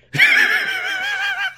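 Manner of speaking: squealing burst of laughter; high laughing fit. Real, not acted. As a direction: crack up at the voice. Breaks up laughing.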